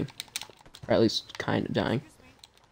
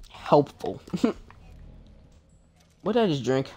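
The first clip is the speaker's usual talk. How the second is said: ordinary talk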